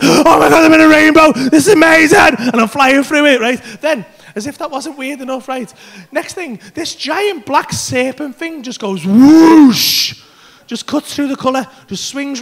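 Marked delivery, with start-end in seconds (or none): 0.0-2.4 loud, slightly breathless wonder; loud amazement. Retold or imitated. Teller flying through a rainbow, loud awestruck joy. 9.1-10.2 long drawn-out swooping whoosh; imitated whoosh. Giant serpent flying by, long loud whoosh.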